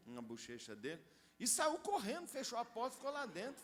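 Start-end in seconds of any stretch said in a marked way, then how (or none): 1.4-3.6 higher, louder burst of excitement; excited retelling. A narrator's reading. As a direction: tell it like a breathless escape. Running off after the kiss. (narração empolgada, mais aguda e mais alta)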